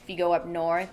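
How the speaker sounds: normal talking voice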